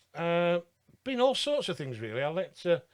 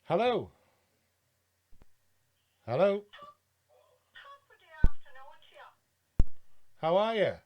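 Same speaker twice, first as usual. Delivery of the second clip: shouting into a phone; 2 semitones higher than usual. Real, not acted. Calling into a silent phone line.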